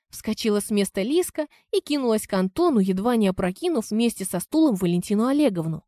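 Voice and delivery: ordinary delivery, nothing marked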